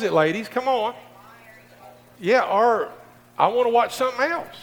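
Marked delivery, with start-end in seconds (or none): 3.4-4.5 high, loud, quick mock wife; wife's real meaning. Preacher translates wife's question to men, high quick mock demand. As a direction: blurt it out as the wife, pitched up. Voicing what the wife really meant.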